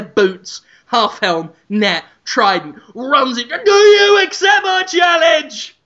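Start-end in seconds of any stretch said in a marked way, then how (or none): 3.5-5.8 kitchen gladiator shout. Acted. Ramsay kicks in kitchen door.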